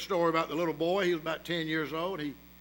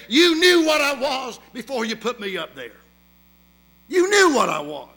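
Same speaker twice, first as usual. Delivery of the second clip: loud, higher accusing snake voice; snake's cold retort; 7 semitones higher, 12 dB louder than usual. Acted. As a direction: throw it back at him as the snake. Snake answers dying boy.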